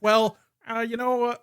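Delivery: an impression that came out as the wrong man; Jordan Peterson. Podcaster mocking a sad tweeter, slipping into a Jordan Peterson voice. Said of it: "why did I do a Jordan Peterson voice".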